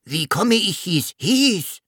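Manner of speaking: high small dragon voice, puzzled question; little dragon asking. A narrator's reading. Little blue dragon just wished here, high puzzled question. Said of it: "Der kleine blaue Drache fragte".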